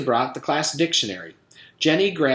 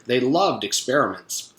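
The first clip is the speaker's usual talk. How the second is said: ordinary delivery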